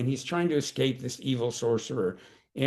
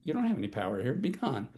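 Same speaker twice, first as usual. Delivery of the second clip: quiet certainty; 5 dB quieter than usual. Shown as a demonstration. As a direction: say it with quiet full knowledge of power. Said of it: "coming from full knowledge of power".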